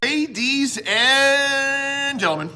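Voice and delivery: high, drawn-out ring announcer call. Pretend. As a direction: stretch it out like a show opener. Show host's announcer opening.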